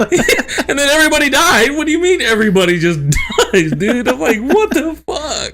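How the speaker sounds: high, loud incredulous echo; laughing disbelief. Retold or imitated. Son echoes mom's spoiler back, loudly incredulous and laughing. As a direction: repeat it aghast through laughter.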